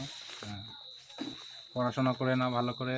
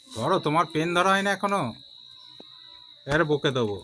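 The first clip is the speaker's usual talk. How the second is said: scolding; 4 semitones higher, 6 dB louder than usual. Real, not acted. Scolding child over pen.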